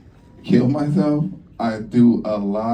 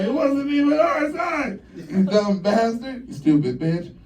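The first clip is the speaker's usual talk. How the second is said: high yelling at the other lane; high mock road-rage yell; 10 semitones higher than usual. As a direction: yell at drivers across the freeway.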